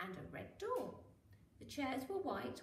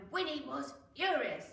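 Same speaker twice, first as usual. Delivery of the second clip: louder, punchy read of her anger; mock furious; 4 semitones higher, 7 dB louder than usual. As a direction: make her fury sound big for kids. Winnie angry.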